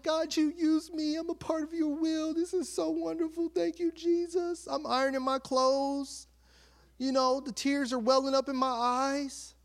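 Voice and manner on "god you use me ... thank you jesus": high, overcome thank-you to Jesus; acted-out gushing gratitude; emotional prayer. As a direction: pray it high and sustained, swept up in emotion. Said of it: "Like, I'm so emotional".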